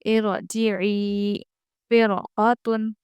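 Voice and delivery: slow इरजिई and फिरकतुन with a full ra; heavy ra recitation. Shown as a demonstration. Teacher reciting examples slowly with a heavy ra. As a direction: stretch both words, ra thick. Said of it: "फिर रॉ पुर पढ़ेंगे".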